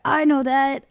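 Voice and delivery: imitating an excited acquaintance; excited recognition. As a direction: blurt it out like eager strangers. Acting people's excited recognition.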